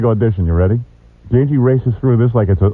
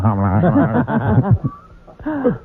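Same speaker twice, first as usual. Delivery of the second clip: Ralph Kramden sputter; 5 semitones higher, slower than usual. Retold or imitated. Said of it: "You're like Ralph Cramden".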